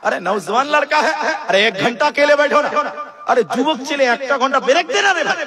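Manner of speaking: put-on the angry speaker yelling at a boy who wants a break; angry retold shout. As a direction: bark at the boy in Hindi, annoyed. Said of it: "বক্তা তখন রেগে গিয়ে বলছে".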